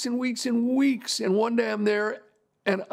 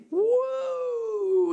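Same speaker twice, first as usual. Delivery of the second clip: long, high-pitched mock holler; retold shout. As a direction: imitate a whoop of joy with one long, high cry. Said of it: "he shouted".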